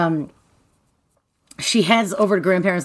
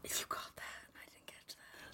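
real hushed whisper; whispered aside; 27 dB quieter than usual. Real, not acted. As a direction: whisper it under the main conversation. Aside admitting she missed a detail.

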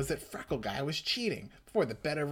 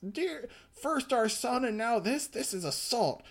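high accusing voice; 9 semitones higher than usual. Acted. Mom accusing.

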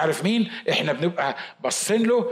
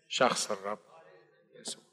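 much softer, lower, hushed reverence; reverent hush; 7 semitones lower, 10 dB quieter than usual. Real, not acted. Preacher naming the Lord to his congregation, a soft reverent hush. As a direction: drop the voice in awe.

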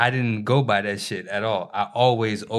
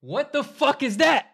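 incredulous yell. Real, not acted. Heated argument over brooming.

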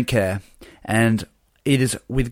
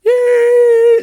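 high squealing yay; rejoicing cheer; 6 dB louder than usual. Pretend. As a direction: cheer like a celebration. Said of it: "That was me rejoicing".

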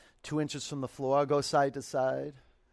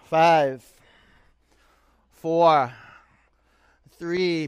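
loud count; 3 semitones higher, 13 dB louder, much slower than usual. Real, not acted. Counting down a held pose.

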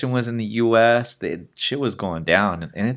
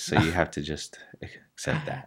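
monotone voice; 5 semitones lower, 9 dB quieter than usual. Shown as a demonstration. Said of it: "monotone voice".

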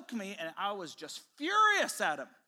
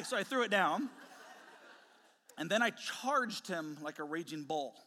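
ordinary talk

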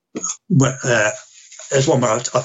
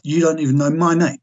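higher-pitched, aggrieved accusation; indignant retold complaint; 5 semitones higher than usual. Hurt patient addressing therapist who forgot his name, indignant. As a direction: replay the grievance with some indignation.

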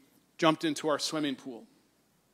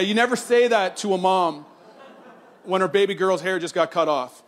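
ordinary talk

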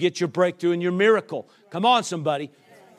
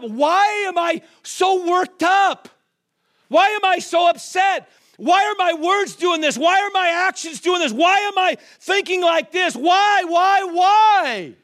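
loud, high, building preacher's shout; preaching at full force; 9 semitones higher, 3 dB louder than usual. Acted. Preacher crying out to the congregation, full force shout. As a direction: hammer each why, climbing.

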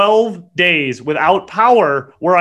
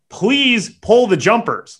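exasperated; 7 semitones higher, 3 dB louder, slower than usual. Retold or imitated. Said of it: "And I go".

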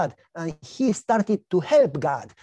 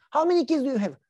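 much higher mocking neighbor voice; teasing neighbor; 8 semitones higher, faster than usual. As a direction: needle him like a nosy neighbor. Neighbor Jim.

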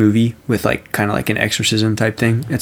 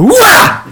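very high, loud "wah" scream; sudden shrieked yell to startle someone; jump-scare yell; 8 dB louder than usual. Retold or imitated. Creeping up behind Avery to scare them, startling yell. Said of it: "And I just went".

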